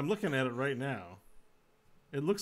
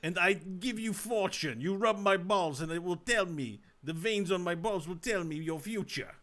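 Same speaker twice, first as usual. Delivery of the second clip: lightly voiced mock Russian fortune teller, a bit higher; a hammy mystic peddling fortunes in a put-on accent; Rasputin fortune teller; 5 semitones higher, 4 dB louder than usual. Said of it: "famous bad for Rasputin".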